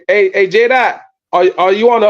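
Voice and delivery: normal talking voice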